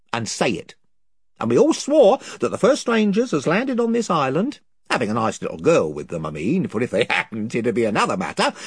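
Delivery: rough, eager island chief, rambling on; a lightly voiced gossiping chief, wheedling. A narrator's reading. Chief voice threatens politely.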